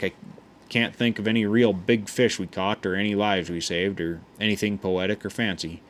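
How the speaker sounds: plain reading voice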